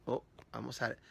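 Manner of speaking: ordinary talk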